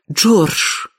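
put-on high-pitched, gushing young lady's voice; delighted exclamation. A narrator's reading. Miss Sophia thanks George for his errand, high delighted exclamation. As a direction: exclaim it in a lifted girlish register. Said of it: "воскликнула она".

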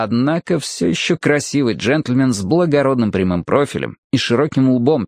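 ordinary delivery, nothing marked

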